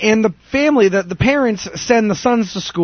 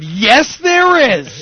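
high, sudden retold shout; explosive outburst; 5 semitones higher, 3 dB louder, much slower than usual. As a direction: blurt it out in exasperation, drawing out the end. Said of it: "I just explode".

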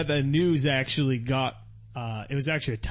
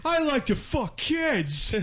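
hammed-up stage-comic impression; mock comic confession; 12 semitones higher than usual. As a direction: stretch it out high, as a goofy confession.

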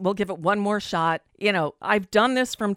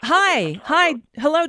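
bright, raised hello down the line; loud phone greeting; 7 semitones higher, 5 dB louder, slower than usual. Real, not acted. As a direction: call out to get attention.

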